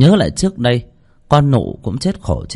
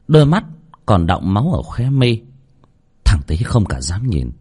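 usual speaking voice

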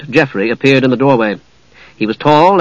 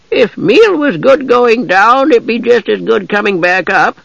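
high, slow creature voice, arguing; perked-up simple-minded dwarf; brightening gully dwarf; 9 semitones higher, slower than usual. A narrator's reading. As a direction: argue cheerfully in a drawn-out high voice. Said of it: "the gully dwarf argued, brightening".

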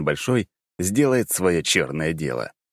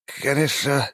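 hoarse voice; 8 semitones higher, slower than usual. Acted. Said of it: "сиплым голосом ответил он".

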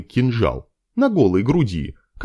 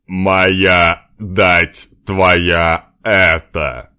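slow, loud broken monster speech; caveman; 6 dB louder, much slower than usual. Acted. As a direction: grunt it like a caveman. Monster speaks broken language. (пещерный человек)

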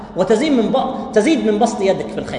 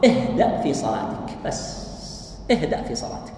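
calm; 7 semitones lower, 7 dB quieter than usual. Shown as a demonstration. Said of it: "بكل اختصار".

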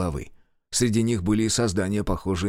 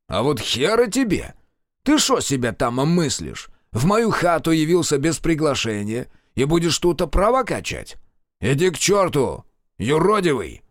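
high, loud, furious rebuke; angry old man; 6 semitones higher, 3 dB louder than usual. Acted. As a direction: tell him off fiercely. Old man drives off intruder.

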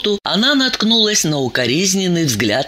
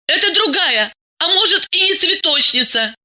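high-pitched, agitated protest; insistent denial; 13 semitones higher than usual. A narrator's reading. Diana argues with Masha, agitated denial. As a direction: grasp at another explanation, pitched high.

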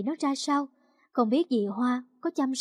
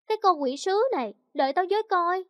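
higher-pitched mock-cross calling after a friend; ý ới gọi theo; 6 semitones higher than usual. A narrator's reading. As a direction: call out to be waited for, pitched up. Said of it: "nó ý ới đạp theo sau". (gọi với theo, giọng cao trách đùa)

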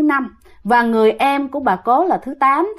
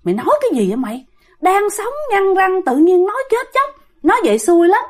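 high-pitched acted scolding of a younger relative; 9 semitones higher, faster than usual. Great-grandmother telling her sister's apparition off, scolding. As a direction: scold indignantly, pitch jumping up. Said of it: "bà cố mới la".

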